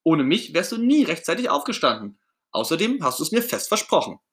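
high, loud outraged protest; indignant Ernie. Acted. Ernie protesting that Bert owes him the tour, indignant. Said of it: "Ernie ist empört".

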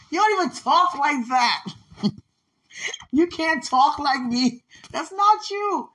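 loud, high mock scolding with laughs; acted incredulous telling-off; teasing rebuke. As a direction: scold playfully, pitched right up. Acts out God correcting her.

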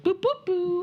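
high, soft little noises; distracted child imitation. Child ignoring parents' call, high soft mimicry. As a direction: play a child lost in their own world.

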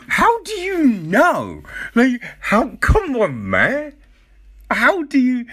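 how could you possibly know, come on; exasperated disbelief. Retold or imitated. Viewer arguing with the documentary, high incredulous disbelief.

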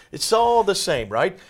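high, loud brush-off; dismissive objector. Acted. Host speaking as doubters who see no difference, dismissive. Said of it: "So they might say".

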